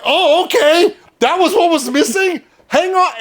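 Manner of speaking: high-pitched mock astonishment; acted, wide-eyed dawning surprise; crowd's surprised realization. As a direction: imitate a crowd suddenly noticing what was missing. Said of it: "They were like".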